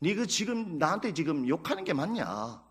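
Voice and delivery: lightly voiced God, quietly taking someone to task; God rebuking Jonah. God questioning the angry prophet, quiet reproof. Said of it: "하나님께 이 요나가 막 썩내고 욕하는 것을 보고".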